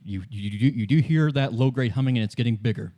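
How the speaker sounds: ordinary delivery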